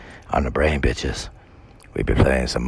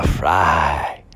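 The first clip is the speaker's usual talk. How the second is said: big, drawn-out radio-style holler on Friday; drawn-out greeting. Pretend. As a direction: draw out the first syllable of Friday. Exaggerated greeting, then whispered repeat.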